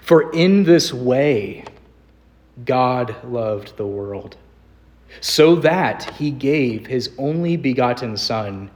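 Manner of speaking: slow, weighty reading of the verse; slow solemn reading. Shown as a demonstration. Pastor reading the verse to his congregation, slow and solemn. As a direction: let each phrase land slowly. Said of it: "quite literally".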